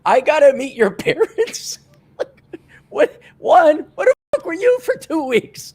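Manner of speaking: very high, disbelieving retort; high-pitched, flabbergasted questioning; acted incredulous outburst. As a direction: retell it in squeaky disbelief. Incredulous reply to the ex.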